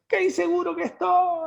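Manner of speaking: high-pitched mock cry of insecurity; acted shout. Voicing what an insecure guy is really saying, mock shout. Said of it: "diciendo a gritos".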